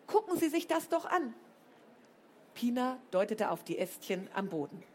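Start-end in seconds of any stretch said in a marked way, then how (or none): 0.1-1.3 higher exasperated demand. A narrator's reading. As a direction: make her look, exasperated. Flo still arguing angrily.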